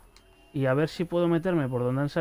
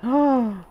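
startled yelp; 8 semitones higher, 5 dB louder than usual. Real, not acted. Startled by enemy in game.

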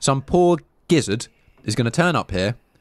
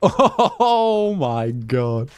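laughing; 4 semitones higher, much slower than usual. Real, not acted. Giddy at the hiding spot.

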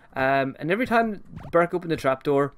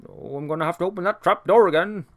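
lightly voiced cartoon servant, cheerful; a hint of the fussy servant Burke greeting his pets; imitating Burke; 4 semitones higher than usual. Burke greets his pets in the kitchen, lightly voiced cheerful servant. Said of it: "Burke actually did sound something a little like that".

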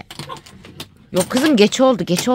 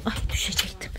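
usual speaking voice

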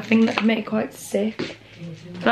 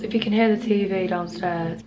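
ordinary talk

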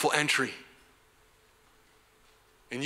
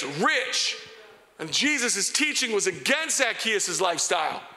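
raised, driving sermon voice; full-force preaching; 8 semitones higher than usual. Acted. Preacher pressing the crowd about Zacchaeus, full force. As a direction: preach it out high and strong to the congregation.

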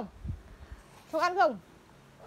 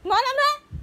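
sharp loud scolding, pitched up; 6 semitones higher, 11 dB louder than usual. Real, not acted. Mother scolding her child over medicine, loud and sharp.

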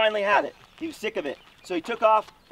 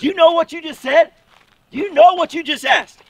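much louder, higher coach's shout; angry bark; 6 semitones higher, 11 dB louder than usual. Retold or imitated. Dad confronting the kid over his claim, furious bark. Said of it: "he barked at that kid".

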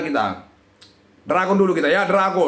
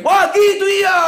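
high, loud burst of excitement; excited shout; 9 semitones higher, 5 dB louder than usual. Real, not acted. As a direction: yell it at the screen in disbelief. Reacting to a slot win.

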